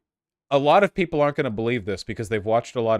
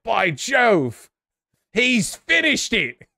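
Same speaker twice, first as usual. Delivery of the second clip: put-on old-fashioned gentleman; 10 semitones higher, 4 dB louder, much slower than usual. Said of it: "he's like".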